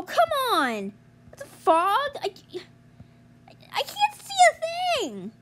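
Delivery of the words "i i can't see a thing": much higher, stammering complaint; high panicked whine. Real, not acted. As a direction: whine in distress. Lost in fog in game.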